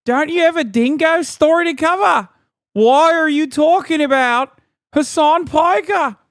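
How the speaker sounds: high, indignant Aussie caricature; mock Australian accent. Streamer scolding the Australian host in her own accent, high mocking shout. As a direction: shout it in broad fake Australian. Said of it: "with the accent like that".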